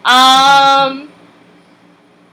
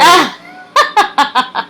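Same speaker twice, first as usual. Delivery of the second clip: laughing yelp; 5 semitones higher, 4 dB louder than usual. Real, not acted. Caught trying a loophole.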